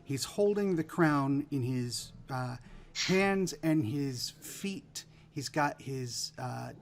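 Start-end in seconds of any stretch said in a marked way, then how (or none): none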